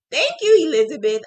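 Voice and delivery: high, loud grateful thanks; gushing thanks. Real, not acted. Thanking a viewer for her kind words, gushing. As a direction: beam the thanks out.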